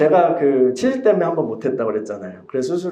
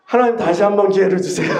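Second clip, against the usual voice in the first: loud, high, pleading cry to God; a worshipper crying out loud in prayer, desperate; 8 semitones higher, 4 dB louder than usual. Retold or imitated. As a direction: beg God for one more chance, voice raised and high. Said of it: "이제 절실하게 했죠".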